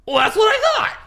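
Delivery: high, loud mock-huffy girlfriend; hammed-up girlfriend slamming the door; girlfriend's huffy retort. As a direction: play the fed-up girlfriend. Girlfriend's hurt retort acted out.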